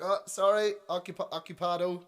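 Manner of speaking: loud, high, hurried call of "occupied"; imitating a flustered call through a door. Caught in the toilet stall, calling out to whoever nudges in.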